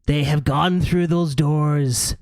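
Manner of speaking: high, sly gargoyle voice. Acted. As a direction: tease them like a mischievous gargoyle.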